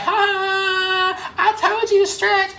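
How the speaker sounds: high, teasing sing-song told-you-so; teacher laughing at the student, sing-song; mocking teacher. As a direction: taunt her like a gloating teacher. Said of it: "She was like".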